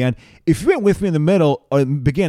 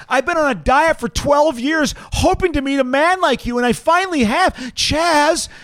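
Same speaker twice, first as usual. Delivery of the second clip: much higher, calling out for Chaz; swooning women; 13 semitones higher than usual. Acted. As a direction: lovestruck woman pleading for his attention. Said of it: "impression right now of the women".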